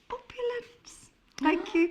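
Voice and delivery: high, slow, grand drawn-out posh word. Shown as a demonstration. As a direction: savour it like a duchess. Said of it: "in a posh voice".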